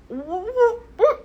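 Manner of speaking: high, stammering shock; stunned stammer. Retold or imitated. Reliving hearing she has parasites, stammering in shock. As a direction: stammer out disbelief.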